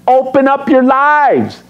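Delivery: high, drawn-out rallying call; slow, emphatic preacher's exhortation; encouragement. Acted. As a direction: urge them on, stretching each phrase. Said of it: "Notice the encouragement".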